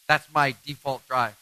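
ordinary talk, nothing marked

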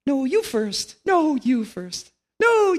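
high, squeaky cartoon chipmunk, the second one slow; polite little cartoon critters insisting; chipmunk voices. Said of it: "the two little chipmunks".

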